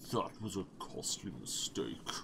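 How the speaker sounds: through gritted teeth. Acted. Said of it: "grits Ubin between clenched teeth".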